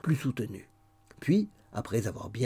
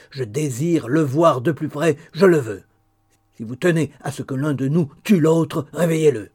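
menacing; 4 semitones higher, 9 dB louder than usual. Acted. Threatening the widow.

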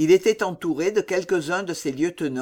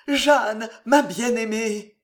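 ordinary delivery

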